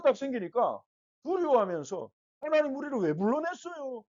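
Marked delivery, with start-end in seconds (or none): 2.4-4.0 high-pitched mock complaint; acted grievance in a raised pitch; resentful whining. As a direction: whine reproachfully, as a wronged crowd. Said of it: "막 이렇게 원망을 했어요".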